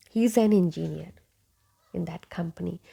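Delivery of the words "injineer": "engineer" as in-ji-neer; mispronounced engineer. Shown as a demonstration. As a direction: start "engineer" with "in". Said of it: "But this is not engineer".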